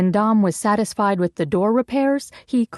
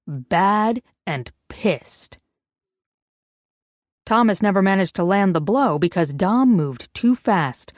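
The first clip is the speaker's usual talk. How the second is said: plain reading voice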